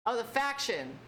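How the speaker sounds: ordinary talk, nothing marked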